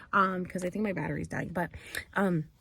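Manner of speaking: ordinary talk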